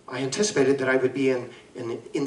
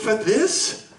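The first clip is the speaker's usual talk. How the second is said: rising, higher disbelieving question; can't believe what he's hearing; incredulous; 8 semitones higher than usual. Acted. Doctor to the lawyer, stunned at the suggestion, rising disbelief. Doctor appalled at legal excuse.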